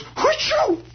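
squeaky high mock sneeze; a tiny toy doll sneezing; high-pitched doll sneeze. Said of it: "The doll sneezes".

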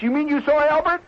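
high-pitched astonished question; incredulous. Acted. Club member hears the guest saw Albert, incredulous. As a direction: ask it in rising disbelief.

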